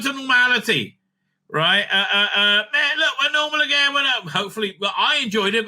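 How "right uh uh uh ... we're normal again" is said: normal talking voice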